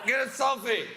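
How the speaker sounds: high, drawn-out mock cry; hammed-up yelp of alarm; mock panicked shout. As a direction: cry out like a drunk woman under attack. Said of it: "She's like".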